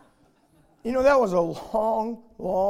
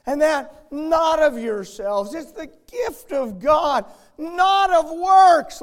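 higher and louder, slowing on works; rising preaching; 8 semitones higher, 9 dB louder, slower than usual. Acted. Preacher reads that salvation is God's gift, swelling preaching. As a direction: build it up and lean on works.

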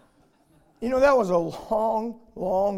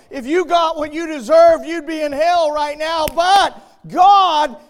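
much louder and higher, then slowing right down on mercy; preaching at full force; 8 semitones higher, 13 dB louder than usual. Acted. Preacher tells the congregation God is rich in mercy, booming.

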